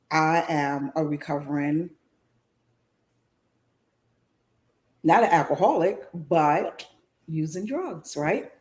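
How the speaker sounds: choked-up admission; low, halting, holding back tears; getting emotional. Real, not acted. Confessing recovery on the show, emotional and halting. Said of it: "I don't know why I'm getting emotional".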